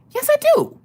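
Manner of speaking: very high, squeaky protest; squeaky insistence. Acted. Wife insisting to her doubting husband, high squeaky protest.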